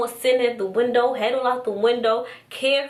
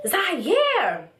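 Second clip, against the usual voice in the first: high-pitched mock yell; imitated screaming; 9 semitones higher than usual. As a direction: imitate her calling out, voice pitched up. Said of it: "What's she screaming for?".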